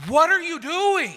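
very high, loud mock-horrified yell; hammed-up exasperated dad shriek; frustrated outburst. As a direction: yell it high, like a dad facing a huge mess. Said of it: "my face in that moment was immediately".

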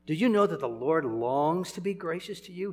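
ordinary delivery

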